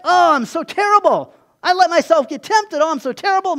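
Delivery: high, loud hammed-up wail of defeat; mock self-pity. Believer mocking their own despair after temptation, high hammed-up wail. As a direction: whine like a defeated sinner, overdone. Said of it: "we feel defeated".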